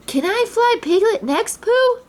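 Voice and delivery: Roo begs to fly Piglet; eager child. A narrator's reading. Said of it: "Roo asked".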